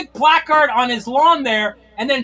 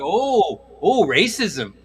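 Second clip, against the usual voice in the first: much lower, slow imitation; low mock voice; 11 semitones lower, slower than usual. Guy on the call takes offense, low mock indignation. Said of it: "And he was like".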